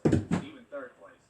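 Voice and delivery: loud, high, slowed punch on third place; big emphasis. Acted. Telling the trophy list, third place punched loud and high. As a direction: land third place like a grand prize.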